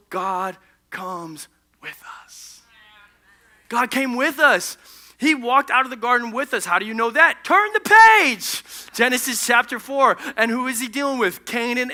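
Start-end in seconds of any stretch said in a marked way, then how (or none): 0.1-2.6 low, soft, very slow punchline; hushed reveal. Real, not acted. Solemn punchline of the sermon. 7.4-8.6 high, loud, drawn-out exclamation; preacher's shout. Real, not acted.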